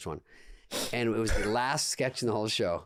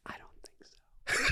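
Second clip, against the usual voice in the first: whisper; much slower than usual. Retold or imitated. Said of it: "And she goes".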